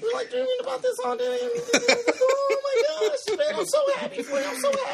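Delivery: very high shout of joy; excited shriek. Retold or imitated. Imagining her reaction, a very high joyful shriek. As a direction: shriek it like a thrilled friend. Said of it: "It was like".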